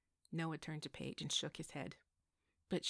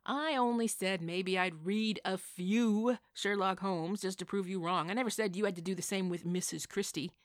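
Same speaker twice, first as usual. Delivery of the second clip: put-on bickering old lady; 2 semitones higher, 8 dB louder than usual. Missus J bickering with Bill.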